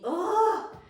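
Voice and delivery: high, loud exasperated ugh; loud frustrated groan. Real, not acted. Annoyed at parents' makeup ban, loud exasperated ugh.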